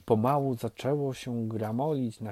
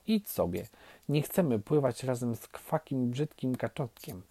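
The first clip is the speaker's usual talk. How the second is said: plain reading voice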